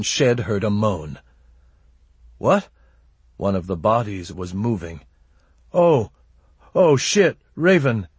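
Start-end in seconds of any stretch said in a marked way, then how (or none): 2.4-2.7 startled. Acted. Shed startled by a moan. 5.7-8.1 high, loud panicked gasp of alarm. Acted. Shed sees a body moving.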